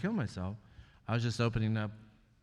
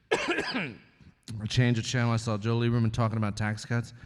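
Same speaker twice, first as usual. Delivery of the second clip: ordinary talk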